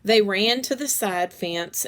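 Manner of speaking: ordinary delivery, nothing marked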